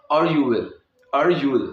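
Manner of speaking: or you will sounding like one word; linked or you will. Shown as a demonstration. Teacher drilling or you will as one word. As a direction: glide or you will together. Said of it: "एक word की sound देगा".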